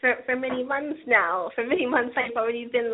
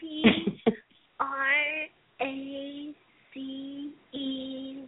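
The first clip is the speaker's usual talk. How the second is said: letter by letter, spaced out; dictating slowly; much slower than usual. Real, not acted. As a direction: spell each letter, very slow. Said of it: "Read it".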